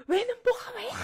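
high, loud imitated scolding mother; shrill-free mock angry mom yelling. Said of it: "그러면 엄마가".

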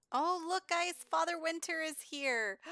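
high, loud announcement; thrilled announcement that Father Winter is here; excited. Real, not acted. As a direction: burst out with delight.

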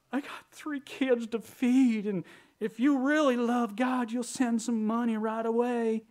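missionary whining for money, mocked; mock begging missionary. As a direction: parody a guilt-tripping fundraiser. Mocking a begging missionary's plea.

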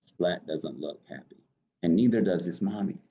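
usual speaking voice

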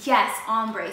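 loud, satisfied yes; loud confirmation. Real, not acted. Vlogger confirming the remembered word, loud pleased exclaim.

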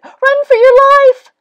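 very high, very loud shriek; all-out panicked yell; rabbit's shout. A narrator's reading. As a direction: scream the warning as a terrified rabbit. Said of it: "shouted a rabbit".

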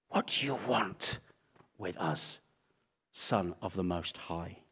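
low, soft, held-back menace; subdued retold yell. Demons confronting Jesus on the island, hushed and held back. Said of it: "ready to scream and yell".

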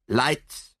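crisp one-word order, pitch lifted; brisk. A narrator's reading. Detective orders the lights back on, brisk. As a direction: call it briskly as a command. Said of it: "said Poirot briskly".

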